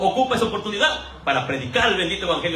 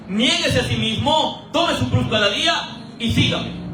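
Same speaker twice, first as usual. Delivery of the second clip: slow reading; 2 semitones higher, slower than usual. Acted. Reading scripture slowly.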